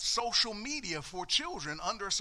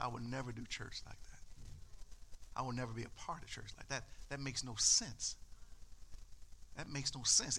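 quiet resolve; 5 semitones lower, 6 dB quieter than usual. Retold or imitated. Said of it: "I said".

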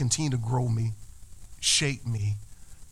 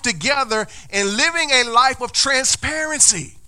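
louder, higher preaching that keeps building; full-force preaching; 7 semitones higher, 8 dB louder than usual. Acted. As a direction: drive it home to the congregation. Preaching climax.

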